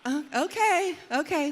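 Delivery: high, flustered okay. Retold or imitated. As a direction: squeak it out, caught off guard. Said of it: "I'm just like".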